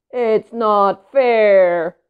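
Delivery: very slow, loud drawn-out whine; sulky whine. Acted. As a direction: stretch it like a sulking child. Pigeon sulking.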